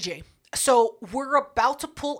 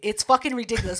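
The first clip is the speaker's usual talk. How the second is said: usual speaking voice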